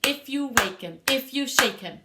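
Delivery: loud forte chant. Shown as a demonstration. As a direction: really project it. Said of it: "That's louder".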